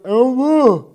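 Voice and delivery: stuffed-mouth urgent answer; mouth full. Retold or imitated. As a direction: push the words out past the sandwich. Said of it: "And he goes".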